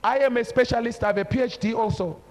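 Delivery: ordinary talk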